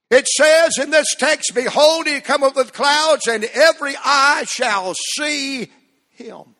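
fervent scripture reading. Acted. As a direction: proclaim the verse to the congregation.

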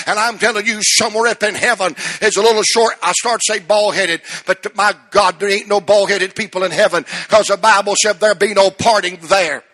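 loud, rising sermon climax; full-force preaching. Acted. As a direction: preach it to the congregation, building.

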